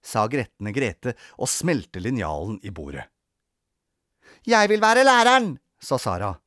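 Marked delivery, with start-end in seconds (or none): 4.5-5.6 high, loud insistence; pushy girl's demand; demanding child. A narrator's reading. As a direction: claim it like a child in a quarrel. Children quarrel over playing teacher.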